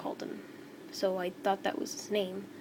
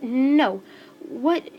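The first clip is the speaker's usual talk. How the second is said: loud, higher-pitched disbelief; shocked protest; 4 semitones higher, 11 dB louder than usual. Acted. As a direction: burst out in acted disbelief, pitch jumping. Girl protests being made to stay.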